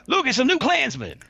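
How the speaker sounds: very high, loud imitation of an inmate's heckle; Love Kills impression. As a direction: call it out across the yard, high-pitched. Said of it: "I speak like he speaks".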